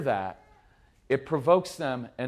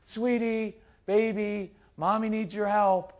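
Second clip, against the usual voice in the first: higher-pitched, sugary mommy voice; really sweet voice; 4 semitones higher than usual. Acted. Mommy asking the strong-willed child for help, syrupy sweet. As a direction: coax sweetly, as if to a small child. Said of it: "start with that really sweet voice".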